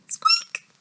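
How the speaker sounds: tiny, very high, soft squeak; mouse squeak. Shown as a demonstration. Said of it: "don't forget to squeak".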